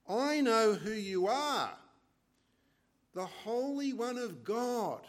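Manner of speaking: loud, high, slow proclamation; evil spirit's outcry. A narrator's reading. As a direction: cry it out slowly as the spirit exposing who he is. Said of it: "evil spirit saying through the man".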